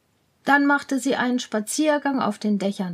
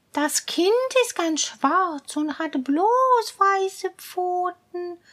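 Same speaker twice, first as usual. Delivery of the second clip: higher, slow pleading cat voice; coaxing cat; 7 semitones higher, slower than usual. Acted. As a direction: spin the tale like a sly cat. Cat asks favour.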